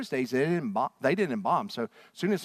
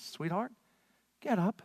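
softer, gentle coaxing sweetheart; tender wake-up; 6 semitones higher, 4 dB quieter than usual. Retold or imitated. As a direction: wake her gently, almost soothing. Simply takes this little girl by the hand.

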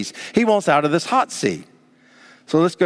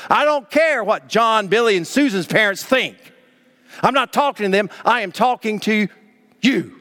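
higher, deliberate scolding with "you" set apart; firm, measured build to a lone "you"; emphatic parent; 5 semitones higher than usual. Retold or imitated. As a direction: imitate a parent insisting, pausing before "you". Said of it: "is emphatic".

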